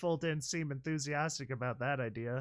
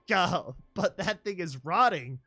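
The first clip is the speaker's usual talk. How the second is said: loud, higher disgusted cry; 4 semitones higher, 9 dB louder than usual. Acted. Watcher recoiling at the corpse, loud disgust.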